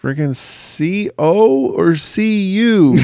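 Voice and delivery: high, slow, spelled-out question; mock alarmed question. Dad asking son which C word, mock alarm. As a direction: stretch out each letter in a high voice.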